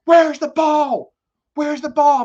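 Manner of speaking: high, loud frantic yelling at the coach; retold yell. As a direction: shout it out while running the bases, desperate to know. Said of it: "And I'm yelling at him".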